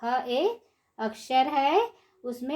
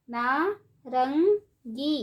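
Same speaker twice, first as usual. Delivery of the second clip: नारंगी stretched slowly, syllable by syllable; slow syllable drill; much slower than usual. Shown as a demonstration. Teacher drawing out नारंगी slowly for children. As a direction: draw out नारंगी for the class. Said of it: "ऊंची आवाज़ में कैसे पढ़ेंगे".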